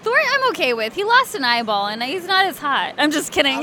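usual speaking voice

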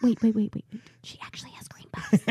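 whispered, trying to stop a partner mid-rant; urgent stage whisper. Retold or imitated. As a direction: hush them in an acted whisper. Retold hushed warning to partner.

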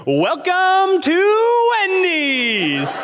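sky-high, drawn-out game show welcome; over-the-top cheery drive-through greeting, loud and slow; happiest intercom greeter. Retold or imitated. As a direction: belt it out like a game show host, stretching every word. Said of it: "the happiest person over the intercom".

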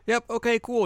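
high-pitched breezy agreement; mock casual. Girl brushing off the others, high-pitched mock casual. Voices over-casual reply in story.

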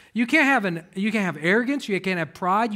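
ordinary talk, nothing marked

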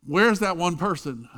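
grumbling complaint. Retold or imitated. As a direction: sound fed up with the wait. Grumbling passengers on held plane.

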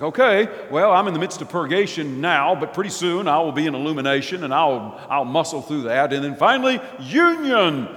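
loud, confident, a bit hammed-up planner; mock the Westerner's tidy plan; half-mocking voice of a self-assured Westerner; smug Westerner. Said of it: "we Westerners think".

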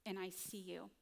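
quiet, loving reassurance; tender whisper-soft. Retold or imitated. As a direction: say it like reassuring a dear friend. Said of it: "he's saying".

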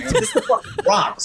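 high laughing glee at the find; gleeful laughing. Retold or imitated. Telling himself about the cockfight ring, laughing with glee. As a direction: laugh it out, thrilled.

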